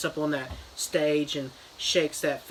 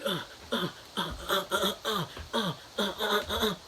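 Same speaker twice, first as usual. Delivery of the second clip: short, higher, softer mock grunts; dab battle grunts; 5 semitones higher, 7 dB quieter than usual. Kids and clown trade dabs, mock effort grunts. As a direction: punch out a string of little grunts. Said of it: "They were always like".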